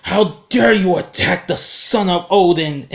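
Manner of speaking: mock-royal affront, chest puffed; indignant Thor. Fan imitating Thor's outrage at the hospital staff, mock indignant. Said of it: "boy, he gets upset".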